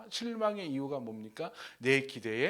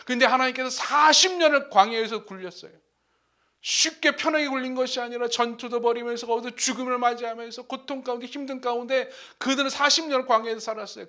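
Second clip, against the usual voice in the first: raised, emphatic sermon delivery; forceful preaching; 8 semitones higher, 10 dB louder than usual. Real, not acted. Preacher expands on the servant's story, preaching forcefully. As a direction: press the point home to the congregation.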